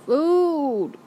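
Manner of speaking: dazed satyr moaning for food. A narrator's reading. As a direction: groan it again, a bit stronger. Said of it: "Grover moaned, a little louder".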